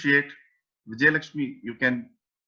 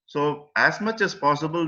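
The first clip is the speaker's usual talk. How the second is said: ordinary delivery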